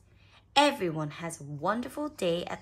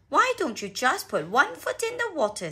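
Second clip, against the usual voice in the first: lightly voiced dad, higher and louder; Daddy Pig encouraging; 9 semitones higher, 8 dB louder than usual. A narrator's reading. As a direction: coax a small child, loud and lively. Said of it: "said Daddy Pig".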